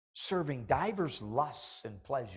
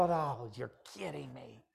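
slow, softer, deflated disbelief; dismayed groan; 5 dB quieter, slower than usual. Retold or imitated. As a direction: let the air out of it, caught. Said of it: "And I go".

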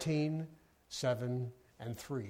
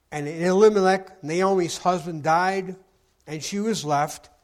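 loud, ringing King James reading with measured pauses; scripture reading; 3 semitones higher, 9 dB louder than usual. Acted. Pastor reading Ruth to the congregation: loud, ringing scripture voice. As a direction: proclaim the text to the whole room. Reads Ruth chapter one.

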